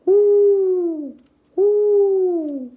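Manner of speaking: loud, high hooting owl call. Acted. Pa calling to the owls in the woods, loud high hoots. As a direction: hoot like a great horned owl. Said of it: "The sound of a great horned owl".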